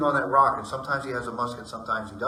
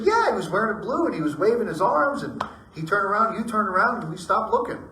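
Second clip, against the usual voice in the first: higher and louder, eager report; excited telling; 9 semitones higher, 6 dB louder than usual. Retold or imitated. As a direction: blurt out what they saw. Wives telling what they saw.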